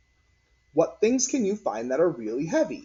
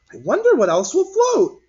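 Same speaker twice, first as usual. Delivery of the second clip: put-on high, loud wondering kid voice; a girl wondering aloud; curious child; 10 dB louder than usual. A narrator's reading.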